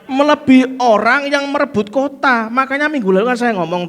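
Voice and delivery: loud, high preaching build-up; fiery preaching. Acted. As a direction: preach it hard to the crowd. Preaching at full force.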